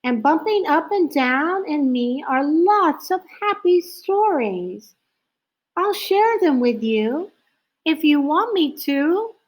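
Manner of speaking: high, hopeful small voice; lonely owl offering its stories; little owl voice. A narrator's reading.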